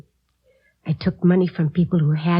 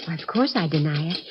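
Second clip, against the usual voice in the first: normal talking voice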